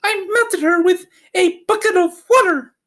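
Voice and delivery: put-on a proud child telling what she did; Dorothy explaining. A narrator's reading. Dorothy telling the wizard how the witch died, high and childlike. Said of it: "she answered".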